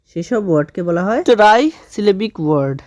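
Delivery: usual speaking voice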